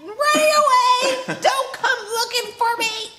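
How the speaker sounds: high, loud, hammed-up shouting; mock runaway yell. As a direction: yell like a bratty runaway kid. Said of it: "what kid who's running away is gonna be like".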